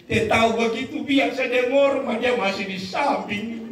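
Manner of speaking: high-pitched mock complaint to God; lamenting wife. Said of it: "Lalu ibu itu berkata begini".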